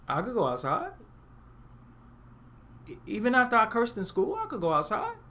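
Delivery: imitated kid, hesitant and questioning; incredulous child. Punished kid to parent, incredulous after cursing in school. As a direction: ask it as if it must be a trick. Acts child baffled by permission.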